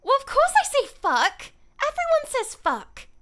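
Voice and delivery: high, loud, cheeky British Tracer; Tracer impression. Streamer doing Tracer for a chat request, high and loud. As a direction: chirp it out like a cheeky hero. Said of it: "just gotta get into Tracer".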